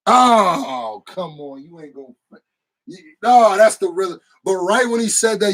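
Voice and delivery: ordinary talk, nothing marked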